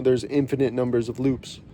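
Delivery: usual speaking voice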